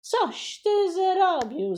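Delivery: high, slow outraged cry; the master cook yells at the boy; horrified telling-off; shouting in dismay. Acted. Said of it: "zakrzyknął wielkim głosem".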